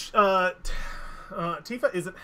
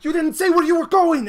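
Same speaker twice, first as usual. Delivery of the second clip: loud, high, frantic scolding; panicked angry shout at a missing friend; freaking out; 10 semitones higher, 12 dB louder than usual. Acted. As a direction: shake him and yell where he's been. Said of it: "she's freaking out".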